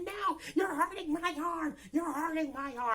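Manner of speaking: high, drawn-out fake whine; mock distressed protest. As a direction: imitate someone wailing while being grabbed. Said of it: "she was just going through it".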